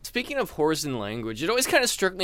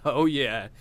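normal talking voice

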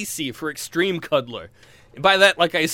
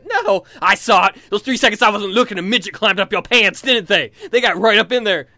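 paranoid loud accusation; 4 semitones higher, 7 dB louder than usual. Retold or imitated.

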